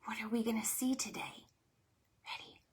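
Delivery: fast hushed whisper; excited secret whisper before the book. Acted. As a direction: whisper to build suspense. Whispered anticipation.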